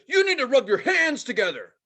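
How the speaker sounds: put-on loud, high kid giving orders; bossy little kid. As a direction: imitate a little conductor barking instructions. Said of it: "it's this little kid dressed like a conductor".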